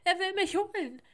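high, loud, stammering panic; terrified. A narrator's reading. Sebastian telling Manuel's mother about the threat, panicked.

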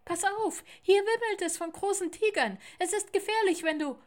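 high, loud and hurried bird voice; urgent woodpecker warning. A narrator's reading. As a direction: warn him before it's too late. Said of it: "rief da ein Specht". (hohe, laute Warnung des Spechts)

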